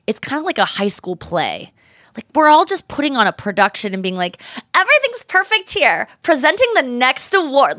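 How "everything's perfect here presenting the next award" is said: much higher, glossy presenter voice; fake award-show voice. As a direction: play a polished award presenter. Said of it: "we're using this voice".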